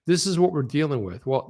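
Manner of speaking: ordinary talk, nothing marked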